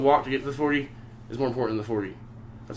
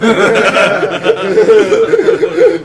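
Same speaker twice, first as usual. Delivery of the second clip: high, loud burst of real laughter; 11 dB louder than usual. Real, not acted. Man unsure his skateboard was ever gone, laughing loudly. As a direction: crack up helplessly.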